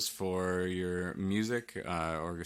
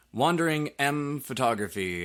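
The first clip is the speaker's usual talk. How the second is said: higher, louder, stretched-out name; announcing a name; 7 semitones higher, 6 dB louder than usual. Real, not acted. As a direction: announce the business name with a lift. Announcing the plugged business name.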